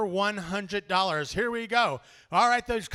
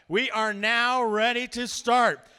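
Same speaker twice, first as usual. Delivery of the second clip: hyped announcement; 3 semitones higher, 8 dB louder, slower than usual. Real, not acted. Host asking the crowd if they're ready, hyped loud call. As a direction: fire up the crowd like a ring announcer. Hyping the crowd for live auction.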